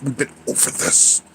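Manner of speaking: hushed weary whisper. Acted. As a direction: whisper it back as a tired aside. Repeating line to chat.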